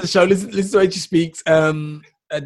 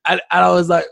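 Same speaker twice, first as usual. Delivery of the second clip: usual speaking voice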